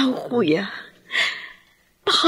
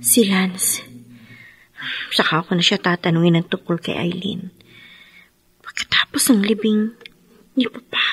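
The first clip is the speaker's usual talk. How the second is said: inner monologue; 4 semitones lower than usual. Acted. Grieving wife's private thoughts at the funeral, hushed.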